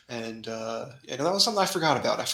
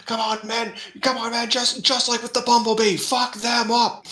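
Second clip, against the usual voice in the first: high, loud mock rally cry; mock rallying yell; 10 semitones higher, 4 dB louder than usual. Mob egging each other on, high loud mock cry. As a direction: egg them on like a riled mob.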